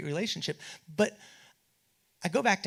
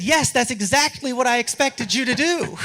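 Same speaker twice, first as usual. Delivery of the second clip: higher, louder, emphatic comeback; insistent, louder rebuke; firm retort; 9 semitones higher, 10 dB louder than usual. Retold or imitated. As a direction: put him in his place. Arguing back with David.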